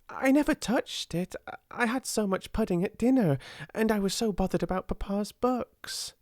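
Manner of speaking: high, eager rush of news; excited hurry. A narrator's reading. Ermengarde telling Sarah about aunt's box, excited hurry. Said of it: "said Ermengarde in an excited hurry".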